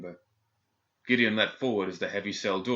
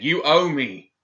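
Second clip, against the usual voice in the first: desperate shout, higher and louder; 8 semitones higher, 8 dB louder than usual. A narrator's reading. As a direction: yell it, slow and pleading. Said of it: "Gideon shouted".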